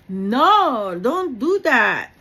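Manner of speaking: loud, slow and firm no; firm warning. Real, not acted. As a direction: stop them in their tracks. Firmly warning the congregation.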